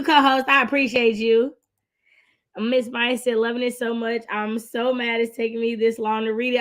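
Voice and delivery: normal talking voice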